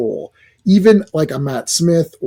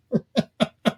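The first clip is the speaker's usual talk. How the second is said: soft disbelieving laugh; 8 semitones higher, 7 dB quieter than usual. Real, not acted.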